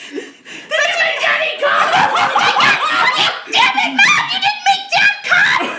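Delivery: high, loud crackhead cat hollering. Acted. Other cat scolds its mom, loud crackhead voice. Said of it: "My other cat sounds like a crackhead".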